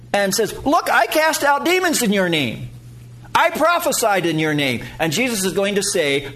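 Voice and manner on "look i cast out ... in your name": Judas boasting. Acted. Judas boasting before God.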